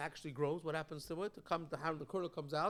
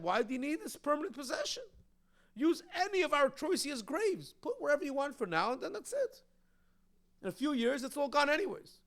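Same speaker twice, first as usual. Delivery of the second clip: higher-pitched, puzzled dismissal; dismissive objection; 7 semitones higher than usual. Acted. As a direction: argue back as if it hardly matters. Voices the Chitim's dismissive objection.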